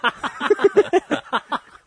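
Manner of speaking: loud, high cackle of laughter; high burst of laughter. Real, not acted. Laughing at being called naive.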